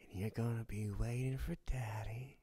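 breathy whisper right at the ear; seductive whisper. Acted. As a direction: whisper it close and low as if kissing the neck. Intimate promise whispered at the ear.

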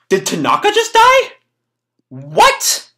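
high, loud incredulous outburst; shocked disbelief. Real, not acted. Reader learns Tanaka may be dead, shocked disbelief. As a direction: shoot the pitch up in disbelief. Narrator's own shock at the story.

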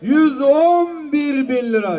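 auctioneer. Acted. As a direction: stretch the price out for the crowd. Auctioneer calls the bid.

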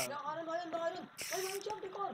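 high-pitched, thrilled discovery; excited call-out. Real, not acted. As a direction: announce each new find with rising excitement. Spots iron and another mineshaft. (उत्साह भरी ऊँची आवाज़)

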